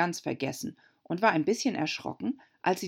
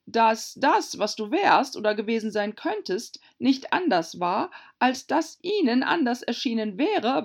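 a higher, louder, slowed duchess tangle; Herzogin, gewunden; 6 semitones higher, 4 dB louder than usual. A narrator's reading. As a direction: wind through the riddle pompously. Herzogin verschachtelte Moral. (Herzogin doziert verschachtelt, höher und lauter)

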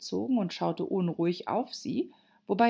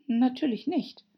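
put-on higher girl's retort; quick-witted retort; 8 semitones higher than usual. Alice answering the Hatter, high and quick-witted. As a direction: answer readily, pitched up. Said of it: "versetzte Alice schlagfertig".

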